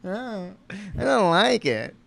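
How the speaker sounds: slow, drawn-out mock whine; whiny. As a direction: whine it out, stretching the words. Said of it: "just whiny".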